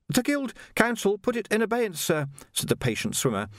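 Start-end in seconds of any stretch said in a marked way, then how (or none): 0.1-2.3 put-on much higher, polite young Jocasta, a touch breathless; young woman voiced. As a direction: play a well-mannered girl treading water. Jocasta explains.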